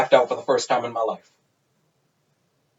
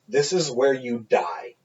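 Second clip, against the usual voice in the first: ominous warning; 4 semitones lower, 7 dB quieter, slower than usual. Retold or imitated. Friend's ominous warning to challenger.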